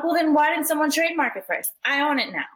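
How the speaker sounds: mock defiant clapback; defiant retort. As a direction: toss it back smugly at the critics. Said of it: "I'm like".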